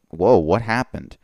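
usual speaking voice

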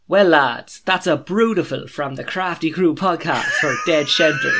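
hammed-up Westmeath accent, half shouting through a laugh; Walshy's Westmeath accent. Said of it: "do your best Walshy impression for Westmeath".

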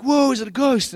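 high, mock-startled exclamation; startled disciples. Disciples spot a figure on the water, acted alarm.

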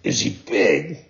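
low, ominous sea creature; dolphin voice. Acted. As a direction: echo it darkly, low. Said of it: "replied the dolphin".

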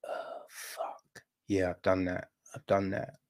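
hushed, low guilty confession; whispered start, then low and subdued; sheepish admission. Acted. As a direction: own up under your breath. Timmy's guilty admission, acted.

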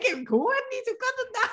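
high, puzzled inner voice. Retold or imitated. Retells her confusion at no sound. (verbaasd, niet-begrijpend)

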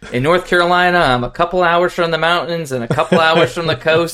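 lightly imitated coach's preseason pitch; Dave. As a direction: recite it like a well-worn coach's line. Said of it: "Dave says this every preseason".

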